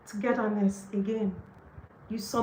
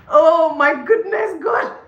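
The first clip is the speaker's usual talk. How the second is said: loud, high, drawn-out burst of delight; overjoyed exclamation; 8 semitones higher, 9 dB louder, slower than usual. Real, not acted. Reader exclaims to God over promised guidance, overjoyed. Overjoyed at what she reads.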